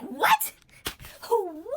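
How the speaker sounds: "What?" shot up very high and loud, then dropping back; outraged disbelief, squeaking up on the first; shocked disbelief. Retold or imitated. Jonah hears God spares city.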